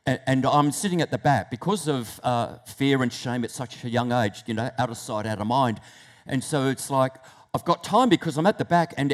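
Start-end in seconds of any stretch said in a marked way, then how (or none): none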